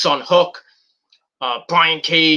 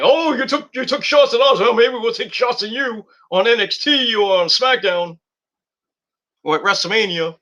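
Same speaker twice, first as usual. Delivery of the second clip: mock WWE reply; 6 semitones higher than usual. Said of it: "they're like".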